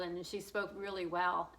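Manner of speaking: ordinary talk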